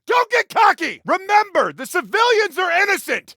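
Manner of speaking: high, loud battlefield yell; urgent shout. Acted. Officer yelling to his brother for help under fire, urgent shout. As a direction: holler over gunfire at full voice.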